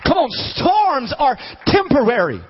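high, rousing sermon delivery; fervent preaching. Real, not acted. Preacher contrasting brief storms with eternity, rousing preaching. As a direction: rouse the congregation with it.

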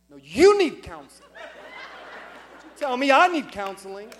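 loud, hammed-up indignant comeback; comic outburst at a wife's advice; mock defensive retort. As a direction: throw it back loudly, playing offended. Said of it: "do you know what I wanted to say".

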